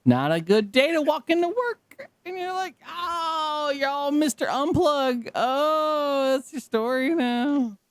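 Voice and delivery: high, teasing coworker voice; mocking coworker. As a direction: tease him in a high mocking voice. Teasing the officer.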